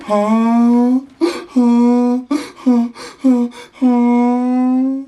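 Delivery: loud high braying ah; donkey bray. Shown as a demonstration. Donkey sound for toddler.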